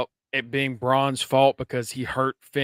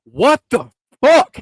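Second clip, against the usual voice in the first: loud, high, drawn-out disbelief; hammed-up incredulous exclamation; mock outburst; 9 semitones higher, 10 dB louder, slower than usual. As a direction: act out a stunned, slow outburst. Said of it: "Priest almost says".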